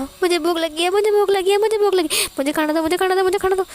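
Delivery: rapid, high, loud wailing repeats; stamp-your-feet tantrum, crying and pleading; fast crying tantrum. Acted. Hungry hero wailing at the shopkeeper for food, tantrum. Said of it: "इतने speed से बोल रहा था".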